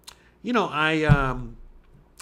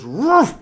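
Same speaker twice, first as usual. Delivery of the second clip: loud, higher sudden woof; explosive mock bark; imitated attack bark; 7 semitones higher, 6 dB louder than usual. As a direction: bark like a dog lunging.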